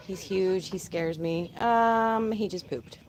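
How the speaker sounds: normal talking voice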